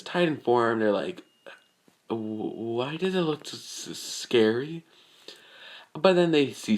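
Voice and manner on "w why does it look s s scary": soft, stuttering fright; nervous stammer. Acted. Everyone seeing Izuku's Titan form, nervous stammering.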